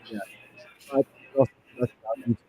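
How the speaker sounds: muffled like through a wall. Real, not acted. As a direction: voice muffled, far from the mic. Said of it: "Wait, you sound muffled".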